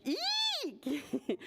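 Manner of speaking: high, squealed eek; acted squeal of fright. A narrator's reading. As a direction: squeal like a startled spider. Spider faces the frog.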